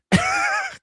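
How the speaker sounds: very high squeal of laughter; squeal-laugh at your own fumbling; loud, high giggle burst; flustered high laugh. Real, not acted. Flustered by screen-share trouble.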